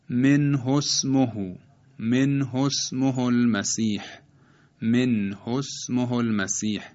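slow recital, short ho in من حسمه; short unstretched vowel. Shown as a demonstration. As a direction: join من حسمه with a brief o, never hū. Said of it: "هو تبدیل به هو نمیشه". («من حسمه» شمرده، با ضمهٔ کوتاه)

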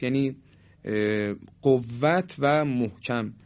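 ordinary talk

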